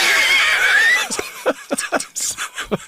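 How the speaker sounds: high-pitched burst of laughter; big laugh. Real, not acted. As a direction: let the laugh fly up high. Laughing at own joke.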